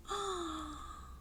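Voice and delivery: high, soft startled gasp; sharp intake of surprise; surprised gasp. Pretend. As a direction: gasp in pretend shock. Pig surprised by own cluck.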